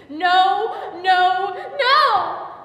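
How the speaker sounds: high, very loud screamed plea; screaming. Acted. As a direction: scream each word out in terror. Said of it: "the big heaving sobs and the screaming".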